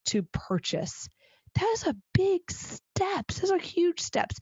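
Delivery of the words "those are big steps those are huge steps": emphatic high pitch. Real, not acted. Stressing size of sales steps.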